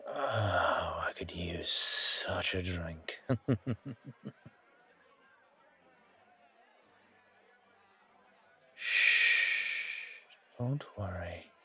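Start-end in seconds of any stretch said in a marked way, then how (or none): 0.1-3.2 slow, half-whispered craving; longing. Acted. Longing for a drink, drawn out. 3.2-4.3 light rippling chuckle, higher in pitch. Acted. Chuckles after craving a drink. 8.7-10.1 long drawn-out "shh"; soothing shush. Acted. Hushing the companion's worry.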